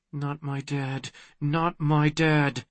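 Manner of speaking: very soft, hushed repeated thought; self-reassurance. A narrator's reading. Daughter reminding herself about the villain, hushed. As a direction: repeat it under your breath.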